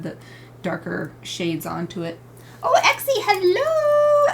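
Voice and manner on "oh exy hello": very high, sing-out hello; excited greeting. Real, not acted. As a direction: greet an arriving friend in a high squeal. Greets viewer arriving on stream.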